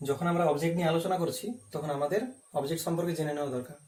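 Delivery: ordinary talk, nothing marked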